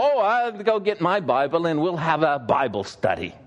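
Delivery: cheerful German accent, hammed up a touch; mock German accent. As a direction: play the eager German lady in a German accent. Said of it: "You like my accents?".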